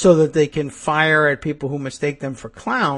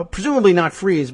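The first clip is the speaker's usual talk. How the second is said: ordinary talk, nothing marked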